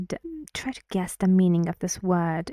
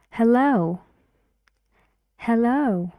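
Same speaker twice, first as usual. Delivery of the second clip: ordinary talk, nothing marked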